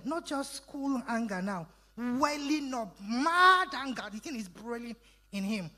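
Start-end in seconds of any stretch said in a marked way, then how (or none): 2.0-4.0 higher, weighty emphasis on MAD; emphatic preaching. Shown as a demonstration. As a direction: stretch WELLING and MAD, pitched up. Preacher dramatizing anger rising in Jesus.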